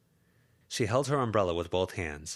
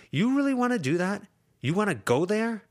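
higher and louder challenge; confrontational; 13 semitones higher, 6 dB louder than usual. Acted. Lucy to Verona, heated challenge. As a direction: dare her to go on.

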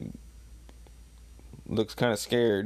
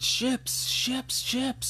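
very high, repeated squeaky exclamations; mock amazed, high little bursts; high-pitched exclamation; much slower than usual. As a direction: pipe each word way up high. Exclaiming while reading the manga.

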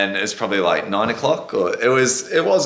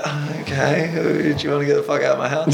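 sarcastic; 5 semitones higher than usual. Retold or imitated. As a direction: fake polite, then blurt it out laughing. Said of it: "He's like".

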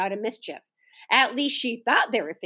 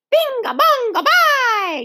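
high, loud gleeful chant; shouted; 13 semitones higher, 5 dB louder, slower than usual. A narrator's reading. Small boy shouting nonsense to make noise. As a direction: yell it just to make noise. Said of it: "shouted Howie".

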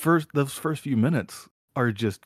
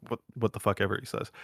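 ordinary talk

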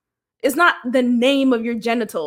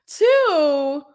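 usual speaking voice

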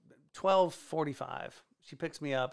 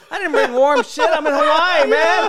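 much higher and louder, laughing; 11 semitones higher, 14 dB louder than usual. Real, not acted. Cracking up over his cold night on the beach. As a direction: laugh it out, high and loud.

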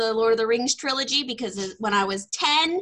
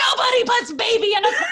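high, loud, fast movie-line yell; yelled movie quote; 13 semitones higher, 8 dB louder, faster than usual. Retold or imitated. Yelling the movie line at her fiance, loud and high. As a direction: belt out the line like a battle cry. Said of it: "I yell that at my fiance".

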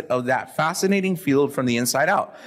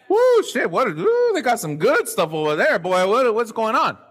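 wild hype; 6 semitones higher than usual. Retold or imitated. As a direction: whoop like you're on a high. Imitating pumped-up attendee.

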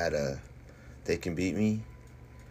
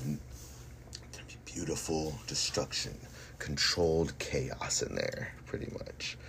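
hushed whisper; 2 semitones lower, 7 dB quieter, slower than usual. Real, not acted. Menacing hushed boast.

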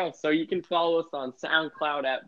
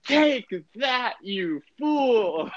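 hammed-up gleeful announcer, much higher; announcer imitation; 9 semitones higher, much slower than usual. Mocking the Bruins announcer cheering a fight, gleeful mock gloating. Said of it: "he'll just be like".

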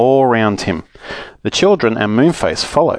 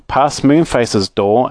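plain reading voice

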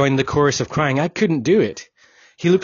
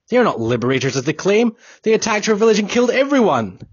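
rising into a higher, louder, faster accusation; accusing the friend of killing the village, growing louder and faster; angry outburst; 9 semitones higher, 4 dB louder, faster than usual. Acted. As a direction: spit out the accusation, building in fury. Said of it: "I felt the anger rising within me".

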